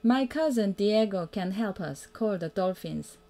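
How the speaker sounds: plain reading voice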